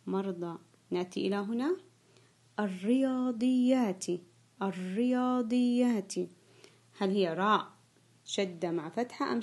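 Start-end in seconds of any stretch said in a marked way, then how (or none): 2.6-6.1 slow dictation. Shown as a demonstration. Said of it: "سوف أنطق الكلمة".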